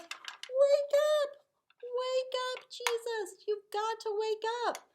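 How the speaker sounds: high, loud frantic calling; acted panicked cry to wake Jesus, high and loud; urgent shout. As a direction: yell to wake a sleeping friend.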